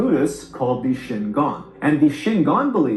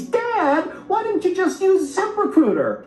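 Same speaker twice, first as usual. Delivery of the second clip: put-on very high child voice; son's voice. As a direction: pipe it up like a kid. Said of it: "in Hungarian means".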